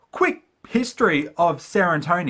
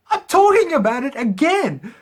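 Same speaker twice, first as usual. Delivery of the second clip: laughing at himself; 10 semitones higher, 5 dB louder than usual. Real, not acted. Catches himself breaking his own rule.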